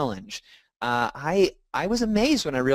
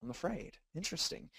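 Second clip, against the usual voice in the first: quiet realization; 3 semitones higher, 14 dB quieter than usual. Retold or imitated.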